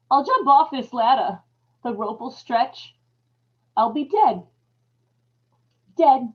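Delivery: loud despair on dead; desperate resolve. Acted. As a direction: steel yourself to jump. Man on ladder, rope on neck.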